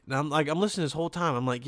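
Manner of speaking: ordinary talk, nothing marked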